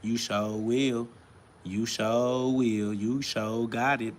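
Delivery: slow, drawn-out mocking repetition; sarcastic sing-song parroting of the commenter; mocking quote.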